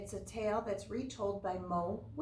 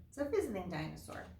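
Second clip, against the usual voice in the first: usual speaking voice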